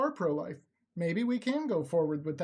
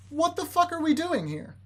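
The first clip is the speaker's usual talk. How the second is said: higher-pitched, incredulous exasperation; exasperated; 7 semitones higher, 5 dB louder than usual. Real, not acted. Debate host confronting a caller who keeps lying, exasperated. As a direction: demand answers, fed up.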